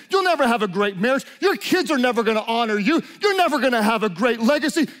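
relentless hammered condemnation, building to a high peak; preacher voicing the accuser with mounting force; devil's accusation. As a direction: pound out each accusation, rising at the end. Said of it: "the devil wants you to believe".

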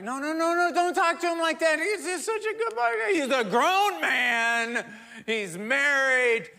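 high, pleading mother; protective mom. Retold or imitated. Mom defending her son to the dad, high and pleading. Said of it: "the mom's like".

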